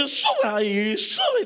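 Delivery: imitated engine whine sweeping very high; plane noise. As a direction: voice the plane climbing. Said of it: "el avión hacía".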